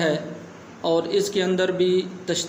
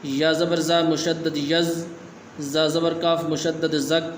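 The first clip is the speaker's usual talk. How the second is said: slow letter-by-letter spelling, like a teacher; slow spelling drill; slower than usual. Shown as a demonstration. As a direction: spell it out slowly for a child learner. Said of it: "آج کا سبق ہم شروع کرتے ہیں".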